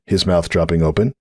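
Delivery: usual speaking voice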